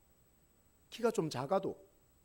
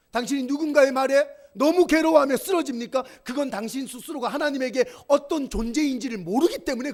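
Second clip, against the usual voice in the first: loud, high, fervent pulpit preaching; full-voiced sermon climax; preaching at full force; 7 semitones higher, 9 dB louder than usual. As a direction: drive each line home to the congregation.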